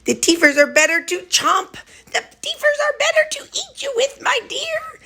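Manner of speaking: very high, playful wolf voice; big bad wolf. Owner voicing her dog as the big bad wolf, very high. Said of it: "He says".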